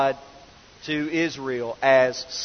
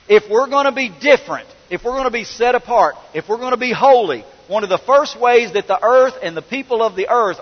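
preaching fervor; 6 semitones higher, 4 dB louder than usual. Real, not acted. Preaching builds to climax.

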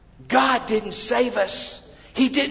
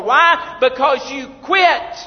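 loud, high rising preacher's cry; preaching climax; 5 semitones higher, 5 dB louder, slower than usual. Acted. Preacher calling out the congregation with loud force. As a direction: drive the point home hard.

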